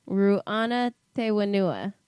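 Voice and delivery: slow, careful "Ruana Te Wenua", each syllable spelled out; careful Māori pronunciation. Shown as a demonstration. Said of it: "one more time".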